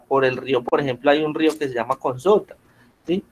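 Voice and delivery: ordinary delivery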